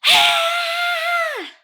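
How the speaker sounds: very high, loud long ah; girl screaming at full force; huge scream. Retold or imitated. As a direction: scream to shake the school. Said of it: "She goes".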